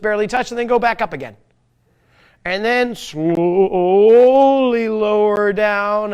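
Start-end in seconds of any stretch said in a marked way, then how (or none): 2.4-6.1 drawn-out slow guiding voice. Shown as a demonstration. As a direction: stretch the words like the slow movement. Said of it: "demonstrates slow lowering".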